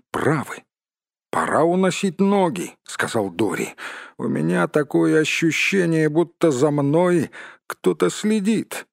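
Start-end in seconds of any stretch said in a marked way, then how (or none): 1.3-2.8 high-pitched, uneasy dwarf; nervous urging. Acted. 4.2-8.9 high-pitched, worried character voice; uneasy dwarf. Acted. Dwarf fears being watched.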